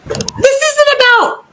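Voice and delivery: very high, tentative guess; Harry. Retold or imitated. Harry starting a guessing game, tentative high squeak. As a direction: squeak out a cautious question.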